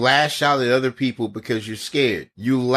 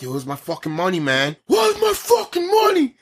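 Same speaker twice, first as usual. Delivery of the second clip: builds from a mutter to a high shouted demand; hammed-up thug shouting for his money; mock thug yell; 7 semitones higher than usual. Retold thug yelling at kid.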